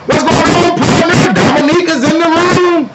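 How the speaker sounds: very high, loud, sing-out greeting; hyped shout-out. Real, not acted. Streamer greeting partner Dominique joining the room, hyped shout-out. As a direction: announce the newcomer in a high, hyped call.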